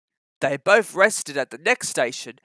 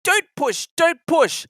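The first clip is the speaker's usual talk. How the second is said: much higher, puffed-out pleading; puffing protest; 11 semitones higher, 3 dB louder, slower than usual. Acted. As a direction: push back against the rush, puffing. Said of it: "puffed Edward".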